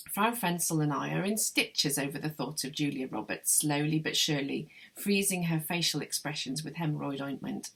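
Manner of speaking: plain reading voice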